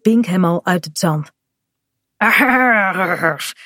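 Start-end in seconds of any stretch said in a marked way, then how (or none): 2.2-3.5 long rattling "Arr"; pirate growl. Acted. Bink snatches the bottle from Kaat, growling like a pirate. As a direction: growl a drawn-out pirate cry.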